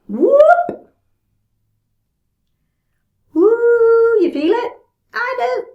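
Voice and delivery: high, loud whoop of effort and delight; excited whoop. Real, not acted. Stretch peak, excited cries.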